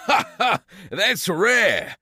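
scoffing amusement; chuckling friend; mocking laugh. Friend mocks the gold digger.

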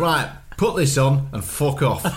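put-on slow, blunt northern accent. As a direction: say it like a curt Northern nurse. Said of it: "I'm gonna put it in a northern accent".

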